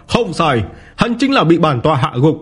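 high-pitched, haughty villain boast opening on a laugh; arrogant boasting. A narrator's reading. Martial rival brags of crippling his foe, arrogant and high-pitched. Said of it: "Tiểu Bá Thiên ngạo nghễ vô cùng".

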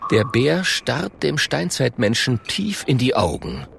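plain reading voice

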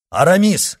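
high, loud call across the field; shouting. Acted. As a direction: yell to stop a friend. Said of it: "крикнул Атос".

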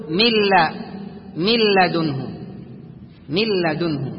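من لدنه said milladunhu, the lam doubled and no nasal; nun dropped into lam. Shown as a demonstration. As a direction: drop the nun of من completely, just a doubled lam. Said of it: "تحذف النون فتقول". (من لدنه بإدغام كامل دون غنة)

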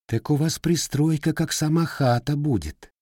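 lightly needling, pitched high; snide. A narrator's reading. Widow needles Matvei about the extension, snide. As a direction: tease him with a sly dig. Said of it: "ехидно отозвалась женщина".

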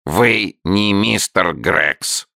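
a curious creature asks slowly; creaky salamander. A narrator's reading. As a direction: drag the words out like a newt. Salamander asks, slow and odd.

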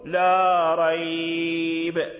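ريب with the ي stretched to a medium length, chanted; chanted Quranic stop. Shown as a demonstration. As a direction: hold the ي in ريب a little longer. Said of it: "تقف بالتوسط".

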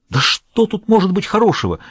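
high-pitched, exasperated retort; exasperated disbelief. A narrator's reading. Lennik answering Dietz's promise of good news, exasperated. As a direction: throw the question back in disbelief, pitched well up.

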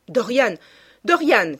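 crying out. A narrator's reading. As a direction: call out the name in alarm, building. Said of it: "cria-t-il". (cri pressant, de plus en plus fort)

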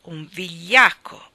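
slow, loud, disgusted cry; boy scolding his cowardly dog; scornful shout. Acted. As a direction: spit out the insult at the dog. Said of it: "gridò Tom e lo prese a calci".

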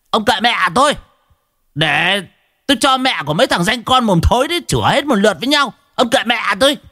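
high-pitched bawling protest; shrill yelling. Acted. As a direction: scream back while being led in. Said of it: "gào đanh đảnh". (gào đanh đảnh, giọng cao vống)

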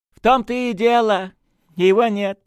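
high, loud, eager confirmation; cheerful. A narrator's reading. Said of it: "весело закивал священник". (весело и звонко подтверждая)

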